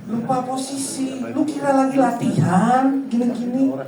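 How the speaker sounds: hammed-up fan's complaint; scolding a player. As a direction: berate the player through the TV.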